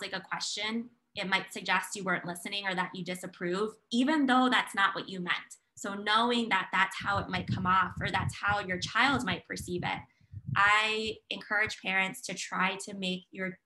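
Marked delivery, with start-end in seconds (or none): none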